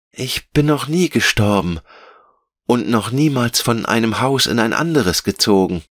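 put-on high, puzzled child-character voice; Veronika, verwundert. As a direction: protest innocently as a little girl. (verwunderte, hohe Kinderstimme)

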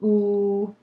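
うー as a long, rounded oo; drawn-out うー, the start of work; first sound alone. Shown as a demonstration. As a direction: hold うー by itself. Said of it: "まずは、うーですね".

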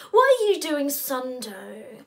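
loud, high phone greeting; chirpy brother. Acted. Brother Flynn ringing about Sunday, loud and chirpy. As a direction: pounce on them with breezy enthusiasm.

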